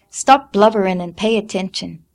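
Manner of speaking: loud impatient rebuke; impatient scolding. A narrator's reading. Mother scolding crying daughter, impatient. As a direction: stop her crying sharply. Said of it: "Leffie said".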